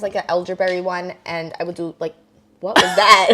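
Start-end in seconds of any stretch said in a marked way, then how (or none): 2.6-3.3 Spanish accent. Real, not acted. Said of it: "why am I Spanish accent".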